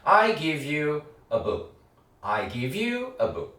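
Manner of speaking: very slow, every word separated; slow drill. Shown as a demonstration. Teacher modeling the sentence slowly. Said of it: "이렇게 연습되겠죠".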